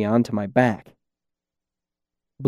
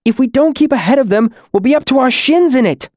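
high, louder, anxious warning; nervous urging; 13 semitones higher, 5 dB louder than usual. A narrator's reading. Green-masked demon warns her friend as horns sound, nervous. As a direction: warn them anxiously, pitched way up. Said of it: "she hissed nervously".

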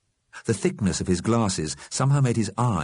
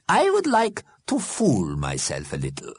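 high, measured character voice; careful; 7 semitones higher than usual. A narrator's reading. Einstein answers Fletcher, careful. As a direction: place each phrase cautiously, pitched high. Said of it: "said Mister Einstein carefully".

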